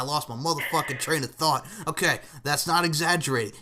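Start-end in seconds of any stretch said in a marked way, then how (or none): none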